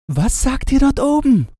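put-on high, eager girl's call. A narrator's reading. Gretchen calls up to the doves, high eager voice. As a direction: call up to the birds. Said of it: "rief Gretchen".

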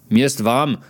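usual speaking voice

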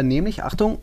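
ordinary talk

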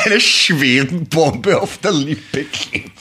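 words squeezed out through laughter; slow, giggling repeat; laughing. Real, not acted. Repeats line while laughing.

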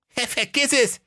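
put-on high cheeky boy voice; cheeky young lad. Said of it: "entgegnete der Aladdin".

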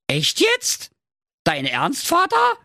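very high incredulous daughter; high-pitched, taken-aback princess; astonished. A narrator's reading. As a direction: question it in squeaky disbelief. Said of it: "fragte Carola verwundert".

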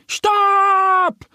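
very high, loud duck call from down the hill; calling from below. A narrator's reading. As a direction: shout up the slope to stop him. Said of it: "schallt es von unten". (lauter hoher Ruf von unten)

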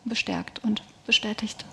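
whispered, voice dropping away; hushed, heartfelt whisper. Real, not acted. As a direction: let the words fall to a soft whisper. Confession of faith, fading.

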